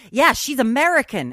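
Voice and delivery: loud, high retort imitating a commenter; mock online commenter. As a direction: throw it out like a cocky reply. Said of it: "who are just like".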